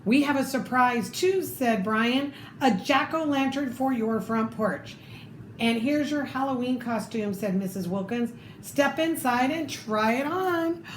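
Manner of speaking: lively read-aloud storytelling voice; story narration. Acted. Reading a picture book to children, lively storytelling.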